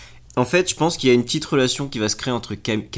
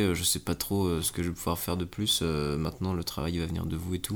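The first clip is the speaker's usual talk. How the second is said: suave voice; 4 semitones lower, 7 dB quieter than usual. Acted. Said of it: "faut que tu fasses avec une voix suave".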